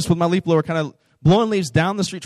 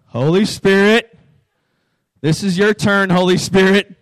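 urgent prayer; 3 semitones higher, 6 dB louder than usual. Retold or imitated. Silent prayer to stay calm.

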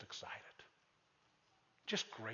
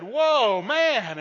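a much higher, much louder "Whoa, man" shout; stunned and thrilled; amazed whoa; 8 semitones higher, 15 dB louder than usual. Retold or imitated. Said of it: "He said".

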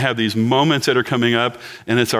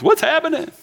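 情景喜剧式高声 What's happening; 用很高的滑稽嗓音说出来; sitcom catchphrase. Retold or imitated. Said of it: "Or sitcoms".

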